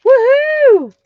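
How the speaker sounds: big high whooping cheer. Real, not acted. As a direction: whoop it out for the winner. Cheering a giveaway winner.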